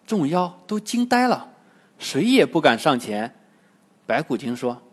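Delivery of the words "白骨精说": ordinary delivery, nothing marked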